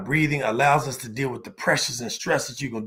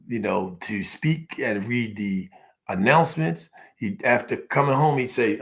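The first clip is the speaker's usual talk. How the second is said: normal talking voice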